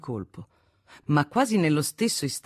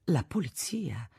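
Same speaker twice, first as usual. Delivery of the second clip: hushed, slow whisper; softly whispered warning; pleading whisper; 7 dB quieter, slower than usual. A narrator's reading. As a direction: whisper it slowly as an anxious plea. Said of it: "lo supplicò di parlare piano".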